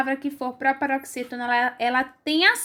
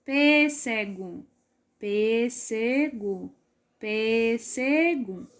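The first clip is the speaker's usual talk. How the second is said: pêssego very slowly in three drawn-out parts, pê-sse-go; syllable by syllable; 4 semitones lower, 4 dB quieter, much slower than usual. Shown as a demonstration. Teacher separating pêssego into parts, slow syllable by syllable. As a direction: count out each syllable of pêssego, evenly stretched. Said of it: "vamos pensar quantas partes".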